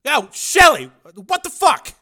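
high, loud shocked yell; startled calling out. Acted. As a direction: call out to a friend in disbelief.

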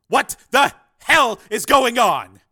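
slow, high roar, word by word; loud outraged shout; shouted demand. Acted. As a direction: bellow it as a furious demand. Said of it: "demanded Cord".

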